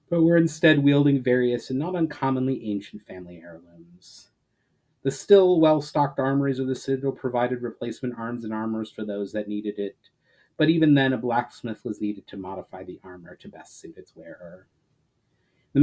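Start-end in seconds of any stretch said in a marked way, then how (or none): none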